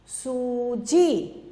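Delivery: सूजी stretched slowly, long ऊ held; drawn-out teaching of सूजी; slow demo word. Shown as a demonstration. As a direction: spell out सूजी slowly for a child. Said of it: "Poonam".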